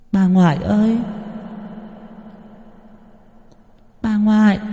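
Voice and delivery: put-on a little boy crying out for his grandmother; child calling.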